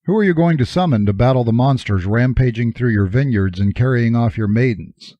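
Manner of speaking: plain reading voice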